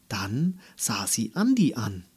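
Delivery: ordinary delivery, nothing marked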